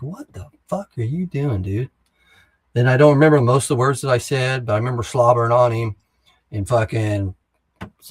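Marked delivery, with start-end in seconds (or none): 0.0-1.9 slow, higher-pitched outburst, not shouted; retold losing it. Sergeant losing it at his peer, retold slow exasperated outburst. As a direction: re-enact the outburst, stretched out and pitched up. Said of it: "And I just lost it".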